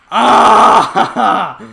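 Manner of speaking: long scream breaking into laughter; loud, exasperated shriek-laugh; frustrated scream. Real, not acted. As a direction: scream at full volume, then crack up. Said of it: "I am so mad".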